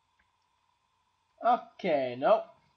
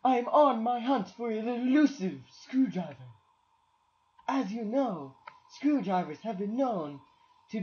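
mock explorer narrator; 4 semitones higher, faster than usual.

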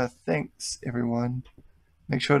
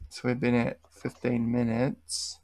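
ordinary talk, nothing marked

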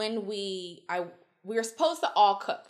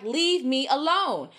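1 slow firm warning; 4 semitones higher, 5 dB louder, much slower than usual. Retold or imitated. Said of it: "And I was like".

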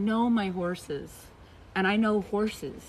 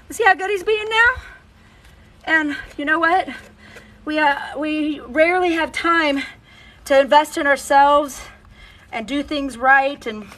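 usual speaking voice

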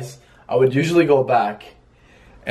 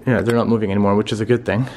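ordinary talk

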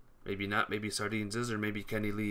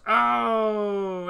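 sudden high yelp of shock; surprised cry; 11 semitones higher, 6 dB louder than usual. Real, not acted. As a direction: gasp it out loud. Players explode around him.

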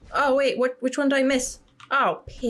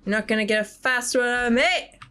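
ordinary delivery

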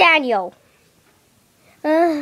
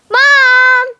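loud high call for mom; 8 semitones higher, 6 dB louder than usual. Pretend. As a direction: shout out to Mom. Calling out for mom.

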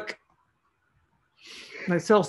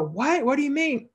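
high, rising disbelief, much higher pitch; retold confusion, pitched up in mock surprise; baffled; 9 semitones higher than usual. Seller answering the customer's angry email, baffled and high. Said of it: "I go".

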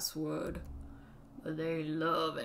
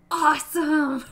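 put-on loud, high kid cheer; excited shout; 9 semitones higher, 15 dB louder than usual. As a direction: yell it with glee.

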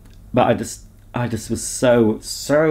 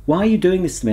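frustrated plea; 9 semitones higher than usual. Retold or imitated. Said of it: "I'm saying".

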